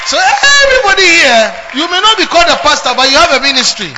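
loud fervent sermon climax; preaching at full force. Acted. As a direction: shout it to the congregation.

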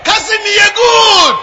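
slow called-out praise. Real, not acted. Preacher calling out to congregation.

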